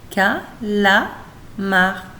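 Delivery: "Calamar" split as Ca... la... mar; slow "Calamar" with a pause after each syllable. Shown as a demonstration. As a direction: sound out "Calamar" in three separate beats. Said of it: "trois syllabes".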